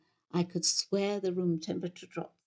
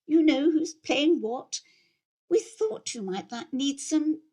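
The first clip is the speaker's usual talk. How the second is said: vicar's wife prompting; 6 semitones higher, 3 dB louder than usual. Acted. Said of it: "said Eunice".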